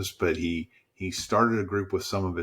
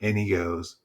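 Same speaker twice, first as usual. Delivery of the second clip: ordinary talk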